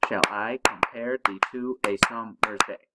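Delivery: "Shall I compare thee to a summer's day" pulsed like a heartbeat, every second syllable accented; heartbeat rhythm. Shown as a demonstration. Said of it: "clap your hands with it".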